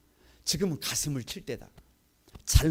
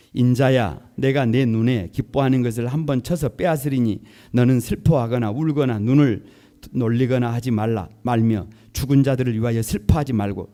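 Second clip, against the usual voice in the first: loud, steady unison reading; loud scripture reading; 4 semitones lower, 10 dB louder than usual. Real, not acted. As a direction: declare the verse together. Congregation reads scripture aloud together.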